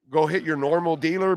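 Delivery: ordinary talk, nothing marked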